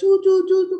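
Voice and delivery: high, loud rapid-fire chatter noise; mock nagging parent. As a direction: rattle it off like a lecturing parent. Mimics parents' nonstop lecturing.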